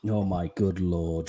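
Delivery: drawn-out disbelieving oh my good lord; exasperated groan. Real, not acted. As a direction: sink into it, unimpressed. Reacting to guest's drinking joke.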